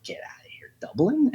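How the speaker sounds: voiceless whisper jumping high on the question; whispered disbelief. Retold or imitated. Student reacting to his acting teacher's answer, incredulous whisper.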